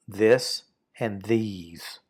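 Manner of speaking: "This and these" spoken slowly and deliberately, word by word; slow model reading. Shown as a demonstration. Teacher modelling "This and these" slowly for the class. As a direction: spell out "This and these" slowly for learners. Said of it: "'cause it's singular".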